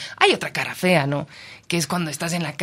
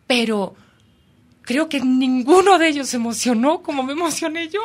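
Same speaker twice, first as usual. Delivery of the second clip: excited; 8 semitones higher, 6 dB louder than usual. Real, not acted. Excited memory of whale trip.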